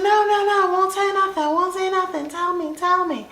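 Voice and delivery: very high, rapid-fire promises; high-pitched, insistent mock pleading; eager begging. Retold begging, promising secrecy.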